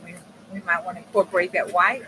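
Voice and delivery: ordinary talk, nothing marked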